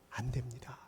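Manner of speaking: hushed whispered answer. Real, not acted. Preacher answering his own question to the congregation, whispered. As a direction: drop to a whisper for emphasis.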